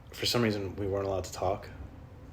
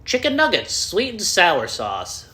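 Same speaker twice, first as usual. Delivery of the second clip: much higher, louder, the sauce drawn out very slowly; deadpan food-item retort; 9 semitones higher, 6 dB louder, much slower than usual. Pretend. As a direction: answer with a silly menu. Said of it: "start saying random food items back to them".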